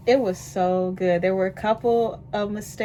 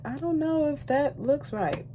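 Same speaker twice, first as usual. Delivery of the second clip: higher, mildly mocking doubter; mock doubting critic; 9 semitones higher than usual. Mimicking a critic who doubts her eye makeup, mildly mocking. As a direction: lightly voice the skeptic. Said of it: "somebody be like".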